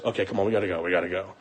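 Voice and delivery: low, very soft imitation of the sister; hushed urging. Sister telling them they gotta go, hushed urging. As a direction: coax them away under your breath.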